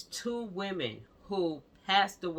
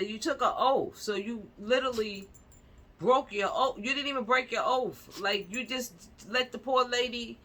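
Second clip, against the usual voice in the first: heated rant; 5 semitones higher, 4 dB louder than usual. Real, not acted. Ranting angrily about doctors' oath.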